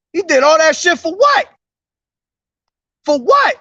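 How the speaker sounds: can't believe he just left; high, exasperated for what; incredulous outrage. Real, not acted. Angry at departing man.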